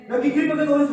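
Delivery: loud, high burst of indignation; a loud, high preacher's outburst; angry outburst. Acted. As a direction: blurt it out, fired up. Preacher mimics angry reaction.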